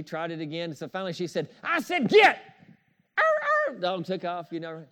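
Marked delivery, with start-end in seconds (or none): 1.6-2.4 high, loud, sharp shout of get; loud shooing yell. Retold or imitated. Lady at the train station yelling at her dog, loud shout. 3.2-3.8 mock shooing growl. Shooing the dog.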